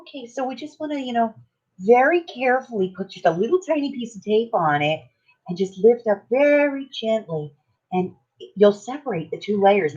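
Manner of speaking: high, soft, careful tutorial imitation; sweet and gentle. Crafter demonstrating tape on paper very carefully, sweet and gentle. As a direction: mimic an ever so gentle, painstaking crafter. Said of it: "she's just being so gentle".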